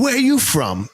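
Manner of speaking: curious Boston local; Boston accent. Retold or imitated. Driver asking where I'm from, Boston accent. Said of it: "switch accents quick".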